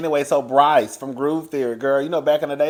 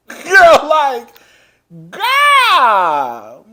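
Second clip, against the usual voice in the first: loud, drawn-out cry; exasperated shout; 8 semitones higher, 10 dB louder, much slower than usual. Real, not acted. Gossiping to viewers about a loud castmate, exasperated shout. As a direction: holler it out long, in exasperation.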